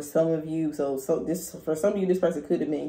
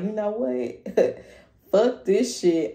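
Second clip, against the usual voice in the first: higher and slow, drawn out; fed-up outburst; 5 semitones higher, much slower than usual. Pretend. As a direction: done with it all. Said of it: "You're just like".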